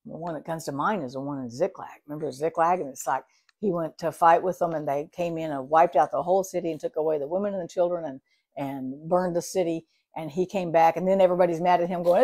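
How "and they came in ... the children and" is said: normal talking voice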